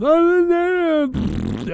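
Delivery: a hint of a whiny troll; mocking whine. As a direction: parody the twins' fake dismay. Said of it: "Oingo Boingo twins saying".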